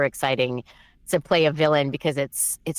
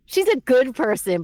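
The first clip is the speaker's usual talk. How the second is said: ordinary delivery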